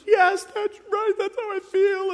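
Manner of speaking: high, loud mock-tearful wail; hammed-up weepy high voice; imitated crying. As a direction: imitate a woman crying out in agreement. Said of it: "she starts crying. She goes".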